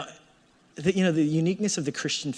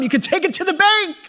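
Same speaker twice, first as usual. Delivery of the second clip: much higher, much louder booming promise; God shouting a sure promise; 9 dB louder than usual. Acted. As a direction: proclaim it like God guaranteeing it. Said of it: "God says".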